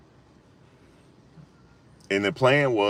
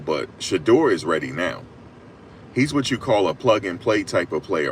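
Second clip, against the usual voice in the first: ordinary talk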